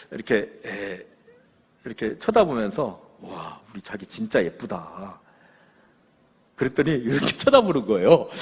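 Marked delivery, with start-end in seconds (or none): 3.2-5.2 low, slow, softly smitten murmur; hushed, lingering compliment in a low voice; tender admiration. Acted. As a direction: admire your sweetheart softly, taking your time. Husband admiring wife in story.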